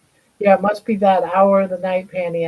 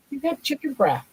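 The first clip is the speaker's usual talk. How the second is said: higher, softer teasing singsong; high pet talk; 6 semitones higher, 3 dB quieter than usual. Acted.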